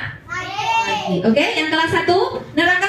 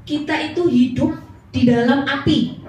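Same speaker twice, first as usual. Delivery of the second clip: ordinary talk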